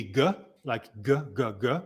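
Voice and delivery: "G" said as a short "guh"; hard G sound. Shown as a demonstration. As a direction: sound out "G" as guh, not the letter name. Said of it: "the G would be G".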